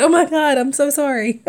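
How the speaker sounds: embarrassed yelp of apology to the friend; retold startled apology. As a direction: blurt it out, caught red-handed. Feet under friend, embarrassed apology.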